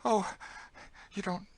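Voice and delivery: high-pitched, anxious story voice; high voice confiding her dread; frightened young woman character. Acted. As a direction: voice a fearful girl, pitched well up. Story figure, frightened Emmeline.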